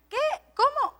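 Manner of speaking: high, baffled what, how; sudden confused question; puzzled what. Retold or imitated. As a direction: sound completely lost. Confused listeners react.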